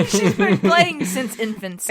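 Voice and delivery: loud joke through laughter; real laughter under loud words; laughing tease. Real, not acted. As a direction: tease a friend while cracking up. Teasing joke amid shared laughter.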